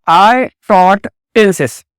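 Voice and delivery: very slow, each word spaced for the class; slow model sentence. Shown as a demonstration. Teacher slowly modelling a sentence for students. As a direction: spell it out slowly so students can follow.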